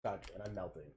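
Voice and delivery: muffled under a sweater. Real, not acted. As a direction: voice stuck inside a sweater. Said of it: "I can't get my sweater off".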